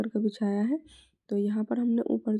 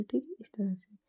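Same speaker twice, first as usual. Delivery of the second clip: breathy whispered aside; 6 dB quieter than usual. Real, not acted. Tailor whispering to herself while stitching the lining. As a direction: whisper it under your breath. Working close, muttering aside.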